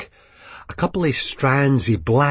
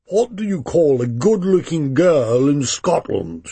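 higher, lightly voiced drunk toff setting up a joke; posh drunk joker; 4 semitones higher than usual. A narrator's reading. Drunk diner asks the table a joke. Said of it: "One says".

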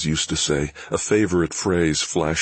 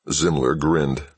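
ordinary delivery, nothing marked

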